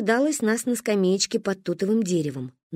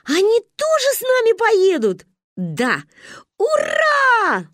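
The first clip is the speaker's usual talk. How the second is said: высокий радостный крик; не веря счастью; восторг; 12 semitones higher, 5 dB louder, slower than usual. Acted. (восторженно)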